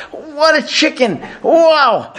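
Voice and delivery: high, gushing praise of the chicken; mock delight. Rabbi voicing a diner raving over chicken, high and gushing. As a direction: rave about the food, hammed up.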